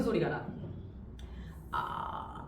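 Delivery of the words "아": breathy voiceless ah, drawn out; ghost film rasp. Retold or imitated. Teacher imitating the Ju-on ghost sound, voiceless rasp. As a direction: breathe out the horror-movie ghost noise. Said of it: "아, 이런 소리가 나".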